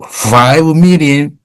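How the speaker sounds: usual speaking voice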